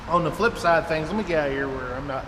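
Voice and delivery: usual speaking voice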